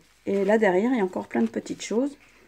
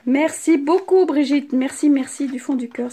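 loud, slow, higher thanks; delighted thanks; 6 semitones higher, 11 dB louder than usual. Real, not acted. Thanking Brigitte for the gift, loud and delighted. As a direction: call out your gratitude with real joy.

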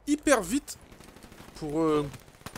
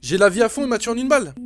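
normal talking voice

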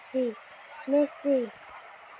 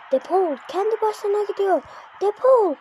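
excited commentary; 7 semitones higher, 3 dB louder than usual. Acted. Commentary on a run.

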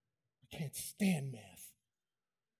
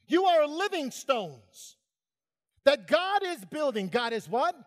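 full-force preaching; 6 semitones higher, 4 dB louder than usual. Acted.

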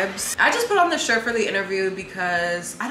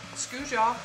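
ordinary talk

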